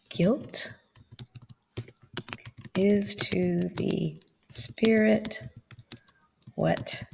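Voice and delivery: slow dictation while typing; dictating slowly. Shown as a demonstration. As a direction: spell it out as you type. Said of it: "I'm gonna say".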